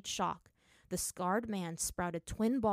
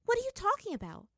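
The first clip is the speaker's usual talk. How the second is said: very high, louder baffled question; perplexed; 5 dB louder, faster than usual. Acted. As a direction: demand an explanation, thoroughly puzzled.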